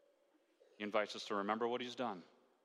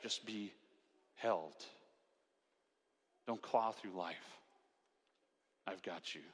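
soft, slow soothing words; gentle reassuring whisper-soft voice of God; tender God; 4 semitones lower, 5 dB quieter, slower than usual. Retold or imitated. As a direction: comfort a crying child, hushed. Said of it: "And God's saying".

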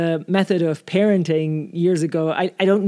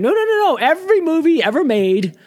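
mock protest; 8 semitones higher, 4 dB louder than usual. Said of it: "if someone's like".

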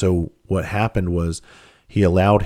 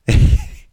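short loud laugh; quick voiceless burst of laughter; 6 dB louder than usual. Real, not acted. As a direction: burst out laughing at your own punchline. Laughs at his own punchline.

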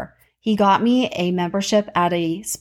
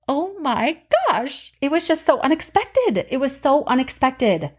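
surprised; 6 semitones higher than usual. Real, not acted. Still shocked by old masseur.

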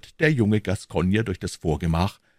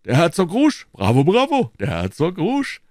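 louder, higher cries of applause; raised, applauding exclamation; delighted cheering; 9 semitones higher, 6 dB louder than usual. A narrator's reading. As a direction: cheer a friend's joke out loud. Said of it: "rief Porthos und klatschte mit den Händen".

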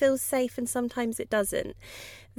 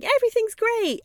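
much higher, breezy cheerful quote; naive cheer; 14 semitones higher, 4 dB louder than usual. Pretend. Voicing a careless mind, high breezy cheer. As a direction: chirp it like nothing could go wrong. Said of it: "go into something thinking".

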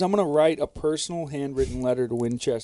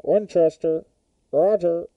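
into a cup; 6 semitones higher, 3 dB louder than usual. Pretend. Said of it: "that's the Winchester speaking".

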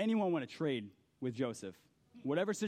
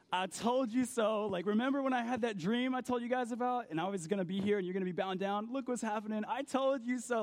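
higher, mock-smug told-you-so voice; gloating; 7 semitones higher than usual. Joseph gloating to his brothers, hammed-up smug. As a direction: rub it in like a vindicated brother. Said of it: "Joseph had every right to go".

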